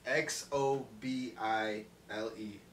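each letter read out slowly, one by one; slow spelled-out letters. Retold or imitated. Reading the dealer's name tag out slowly, letter by letter. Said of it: "How do you say that?".

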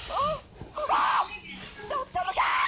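high frightened yelp; panicked cry. Real, not acted. Monster appears in horror game.

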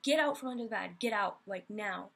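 loud stern order; scolding shout. Retold or imitated. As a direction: order the dog out, fed up and scared. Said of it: "And I'm like".

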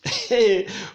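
short high laugh. Real, not acted. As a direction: laugh between sermon examples. Preacher amused at own example.

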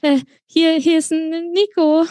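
high-pitched, panicky stutter; tripping over words in fright; nervous stammer. A narrator's reading. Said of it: "stotterte ich mit trockenem Mund".

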